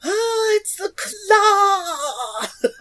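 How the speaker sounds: high, drawn-out cartoonish wonder; hammed-up reverence, very high and slow; mock awe. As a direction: stretch it out in a high, awestruck squeal. Playful awed exclamation about claw.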